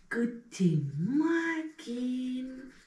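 very slow, drawn-out morning greeting to the teeth; talking to teeth. Pretend. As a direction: wake the little teeth up gently. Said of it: "Seid ihr wach".

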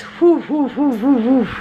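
string of high hooted whews; repeated relieved whews. Real, not acted. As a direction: puff out the scare in quick high hoots. Shaking off the choking scare.

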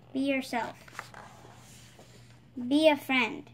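usual speaking voice

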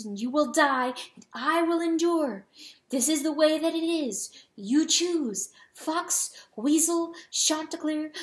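high, emphatic, building tirade; anguished protest. A narrator's reading. Hen protesting that others choose and she endures, anguished. Hen's anguished outburst at her husband.

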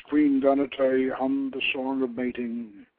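usual speaking voice

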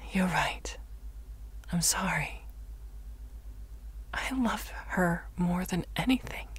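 hushed, regretful sincerity; subdued. Acted. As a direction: back down softly. Mother backs down, daughter professes love.

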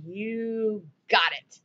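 lightly voiced God, slow and obliging; Lord granting a request. The Lord answering the woman's prayer, slow and softly obliging. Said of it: "And the Lord's ears delighted to hear".

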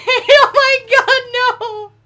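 high, loud, slow crying-laughing panic; half laughing, half terrified. Real, not acted. Train bearing down on him.

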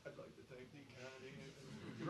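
"I'd like to thank the Academy" as if far from the mic; distant acceptance speech. Retold or imitated. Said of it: "Grammy nominees would be like".

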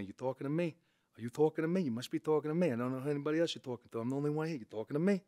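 lightly voiced Taxi Driver mirror talk; a hint of a young De Niro challenge. Shown as a demonstration. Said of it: "That's, that's him".

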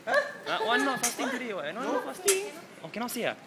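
high surprised yelp. Real, not acted. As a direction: jump up high in disbelief. Surprised yelps about fasting.

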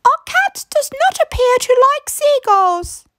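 put-on high, bright old lady voice; wife proposing a plan to her husband; Missus Grinling. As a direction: play a brisk little old wife.

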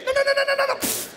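high, loud rapid string of non; frantic refusals. Acted. Surgeon refusing the kiss in the operating room, high frantic yelling. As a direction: panic and wave them all off.

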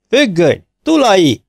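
high, loud furious bellow; howling shout. A narrator's reading. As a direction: roar for the curtain and for Tulai. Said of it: "Üvöltés".

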